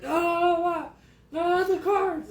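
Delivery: high, loud despairing cry; anguished wail. Retold or imitated. Boy crying out as his mom shreds his cards, high anguished wail. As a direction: wail as your treasures get destroyed.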